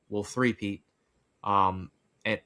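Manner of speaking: ordinary talk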